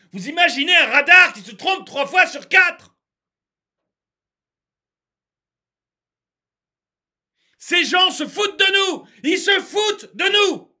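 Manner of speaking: high, indignant shouting; angry rant. Real, not acted. Ranter tells the audience they are being mocked, outraged shouting. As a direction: cry out in mounting outrage. Ranting at the authorities.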